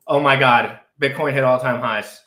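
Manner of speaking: ordinary talk, nothing marked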